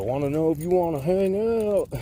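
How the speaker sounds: high, loud roughneck caricature; neighbor impression. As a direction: imitate the odd roughneck neighbor inviting himself. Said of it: "he used to talk like this".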